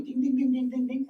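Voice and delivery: high, sing-song dings; little high chimes counting off marching ants; tripping high ding-ding-ding; playful marching sounds. Retold or imitated. Voices ants marching down body.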